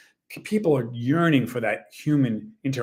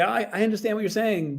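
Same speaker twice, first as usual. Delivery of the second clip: higher-pitched imitation of sympathy; mimicked empathy; 9 semitones higher than usual. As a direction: act out an AI claiming to understand. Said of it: "mimic empathy".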